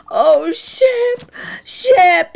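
high, loud acted sobbing over the dog. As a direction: cry out the name as if losing him. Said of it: "he sobbed".

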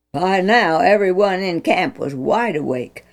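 ordinary delivery

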